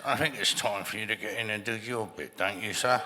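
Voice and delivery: lightly voiced stiff English assistant director; a hint of a stiff old Englishman, neck held still; unhurried, formal English first AD, barely put on. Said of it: "that's exactly how he talked".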